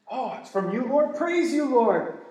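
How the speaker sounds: high, loud, ecstatic praise to the Lord, slowing at the end; gushing praise. Retold or imitated. Preacher acting out his own thrilled reply to God, loud and high. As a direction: burst out in overjoyed worship.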